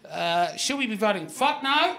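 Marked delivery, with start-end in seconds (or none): 1.3-2.0 laughing. Real, not acted. Reading a blunt answer aloud.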